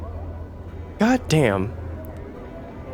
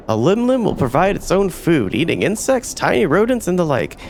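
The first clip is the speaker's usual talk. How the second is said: cheery, higher merchant sales pitch; eager merchant hawking pets; 5 semitones higher than usual. Acted. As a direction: sell the creature like a fairground hawker.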